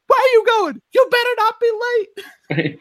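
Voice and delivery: very high falsetto mock yell; yelling wife impression. Podcaster imitating Frozone's wife in the trailer, shrill yell. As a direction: screech it like an angry wife offstage. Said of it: "you just hear his wife yell".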